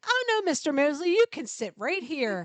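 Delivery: hammed-up gracious host; teasing husband. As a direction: sweetly offer the seat to set her up. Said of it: "He's like".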